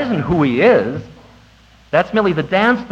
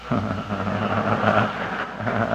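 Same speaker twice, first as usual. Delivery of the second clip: soft chuckle; 5 semitones lower, 5 dB quieter than usual. Real, not acted. Laugh after joke.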